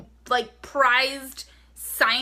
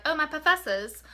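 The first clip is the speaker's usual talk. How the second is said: higher, playful attempt at an English accent; bad English accent; 4 semitones higher than usual. Shown as a demonstration. Vlogger playing at being in Harry Potter, bad English accent. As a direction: put on a badly faked English accent. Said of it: "That was a bad English accent".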